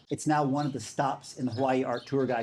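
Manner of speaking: ordinary delivery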